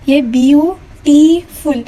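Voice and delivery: ब्यूटीफुल said slowly as three separate syllables. Shown as a demonstration. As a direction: break ब्यूटीफुल into three parts. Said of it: "तीन parts में divide करके".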